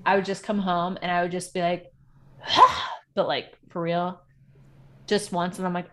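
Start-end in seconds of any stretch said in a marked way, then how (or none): 2.4-3.1 breathy high airy scream; a short release scream after work; primal scream. Retold or imitated. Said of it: "primal screaming like that".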